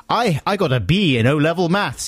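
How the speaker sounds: high-pitched imitation of a braggart; mock boasting. Host retelling his co-host's maths claim to listeners, mock boastful. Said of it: "he boasted".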